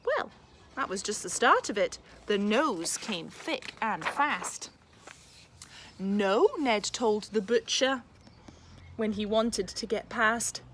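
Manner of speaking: lively, expressive picture-book read-aloud; reading to children. A narrator's reading. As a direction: read it like a bedtime story for a class.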